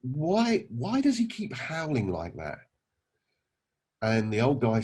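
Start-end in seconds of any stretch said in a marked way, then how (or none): 0.0-2.6 lightly acted, higher-pitched puzzled question; puzzled salesman. Salesman asks old man about the howling dog, lightly acted puzzlement. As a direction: ask as a mildly baffled, polite visitor. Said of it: "the insurance salesman said to him".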